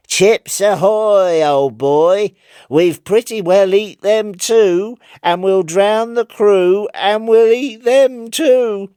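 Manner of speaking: high, loud, slow sing-song rat voice; speaking rat. Acted. The rat on the figurehead taunting Chips, high, loud and slow. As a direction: taunt him slowly in a high squealing rat voice. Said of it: "his exact words were these".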